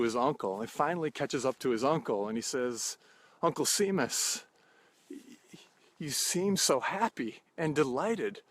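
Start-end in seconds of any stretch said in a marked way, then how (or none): 3.4-8.4 put-on higher, eager young man's voice; eager nephew. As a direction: lift the pitch like an amazed nephew. Ed voiced catching up with uncle.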